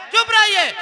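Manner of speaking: high-pitched irritated wife's retort; mimicked exasperated housewife; annoyed wife. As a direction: call back crossly in a high voice. Wife silences husband.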